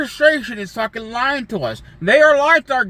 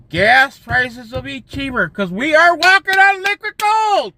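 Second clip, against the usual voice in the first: mocking campaign promise; 6 semitones higher, 4 dB louder than usual. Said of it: "When he said it so".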